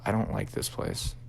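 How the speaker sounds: hushed, nearly whispered; retold quiet unease. Girlfriend walking through the old house, hushed and uneasy. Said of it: "she kinda got quiet".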